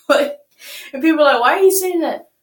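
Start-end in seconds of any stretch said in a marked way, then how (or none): 1.4-2.3 mock objection. Voicing people who object to word.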